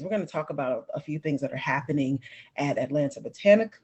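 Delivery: ordinary talk, nothing marked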